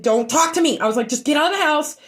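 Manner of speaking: angry retold snap; snapping at husband. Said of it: "I said".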